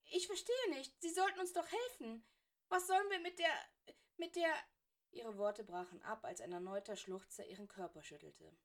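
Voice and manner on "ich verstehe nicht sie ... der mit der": high, loud, breaking with sobs; distraught girl sobbing at her teacher; tearful plea. Acted. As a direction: plead through tears until the words break.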